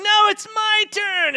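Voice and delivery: put-on high, loud, drawn-out kid protest; child's shouting. Boy arguing over his turn in the shower, shouting. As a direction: shout back like a squabbling sibling. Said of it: "they're shouting and screaming".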